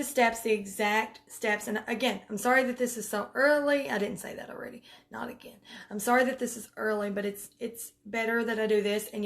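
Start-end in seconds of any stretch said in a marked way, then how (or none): 3.9-5.6 hushed whisper to herself; whispered self-correction; whispered aside. Real, not acted. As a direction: drop to a whisper, half to yourself.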